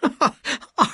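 high scornful laugh; mocking laugh. A narrator's reading. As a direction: laugh at his boast. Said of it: "The woman laughed".

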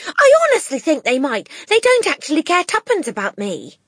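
put-on high, loud eager child; eager girl. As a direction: insist it eagerly. Matilda voiced.

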